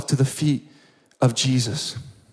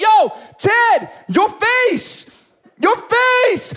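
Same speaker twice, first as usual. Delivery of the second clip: very high, loud cry of wonder; amazed excited shout; 3 dB louder, much slower than usual. Acted. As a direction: yell it at a friend in disbelief. Acts out Robert seeing Ted's face healed.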